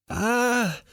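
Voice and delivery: high-pitched startled scream; sudden high yelp; acted scream. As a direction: shriek of a woman seeing a snake. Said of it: "Lael screams".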